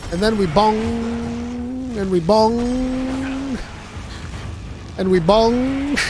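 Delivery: very slow, stretched-out bonging; slow drawn bong. Real, not acted. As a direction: drag out each bong in relish.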